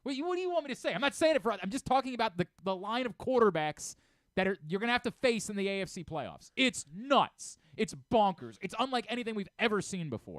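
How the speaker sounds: loud, high ranting coach, fed up with the press; hammed-up furious press-conference coach; Dabo Swinney rant. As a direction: yell it like a coach losing it at the reporters. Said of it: "Losing his mind".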